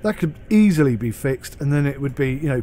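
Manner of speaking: normal talking voice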